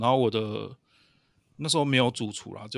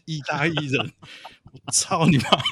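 half-laughing retold curse, a bit louder; mock angry cursing; 4 semitones higher, 5 dB louder than usual. Chef retells cursing the Italian coworker, mock angry. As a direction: replay the outburst, grinning.

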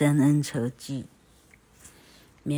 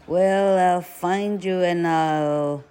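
loud, slow threat; slow, loud scolding threat; threatening; 4 semitones higher, 6 dB louder, slower than usual. Acted. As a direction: warn the boy loudly. Aunt threatening Tom.

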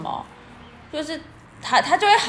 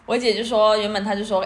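ordinary talk, nothing marked

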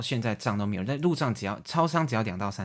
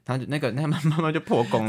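usual speaking voice

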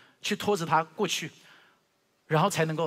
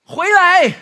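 a real full shout, much higher and much louder; shouting her name; 19 dB louder than usual. Retold or imitated. Father shouts after his daughter running up the stairs, a loud high yell. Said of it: "我就大叫".

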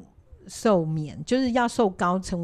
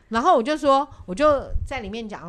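ordinary talk, nothing marked

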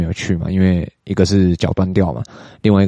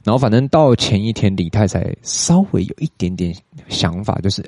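ordinary delivery

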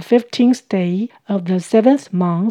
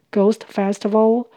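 ordinary delivery

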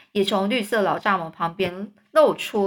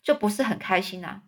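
ordinary delivery

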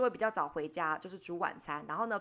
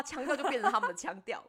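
usual speaking voice

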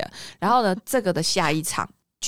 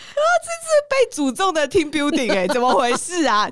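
high, louder laughing wail of mock horror; mock alarm; 4 dB louder than usual. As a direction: ham up the cursed outing. Team building called cursed. (高声夸张地惊呼)

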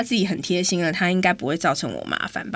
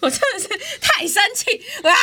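laughing in frustration; 13 semitones higher than usual. Real, not acted.

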